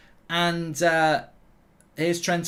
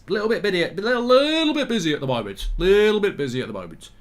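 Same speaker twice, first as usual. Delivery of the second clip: playful brush-off; 3 semitones higher than usual. Acted. Brushing off a viewer request.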